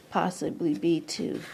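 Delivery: usual speaking voice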